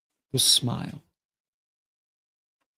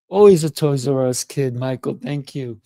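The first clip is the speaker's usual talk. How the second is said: ordinary delivery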